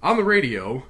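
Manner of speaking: ordinary delivery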